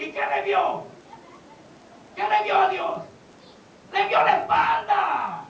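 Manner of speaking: loud, high, punched-out questions and answers; full-force preaching. Acted. Preacher revealing what was seen of God, shouted proclamation. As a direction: drive each phrase home to the congregation.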